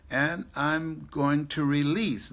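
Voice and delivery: "release" slowed and leaned on hard; stress on release. Shown as a demonstration. Telling the audience he'll release, leaning on "release". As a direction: draw out "release" and land it. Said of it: "not lose".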